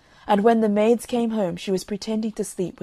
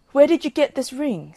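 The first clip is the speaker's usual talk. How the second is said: eager question; 6 semitones higher, 5 dB louder than usual. Acted. Master questions about the ring.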